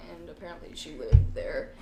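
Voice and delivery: ordinary talk, nothing marked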